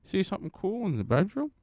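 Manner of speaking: half-hearted creepy Hollywood star voice; brag, then lure him in; Kevin Spacey impression. Mock Kevin Spacey inviting a boy to the bedroom, half-hearted. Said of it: "Is that your Kevin Spacey impression?".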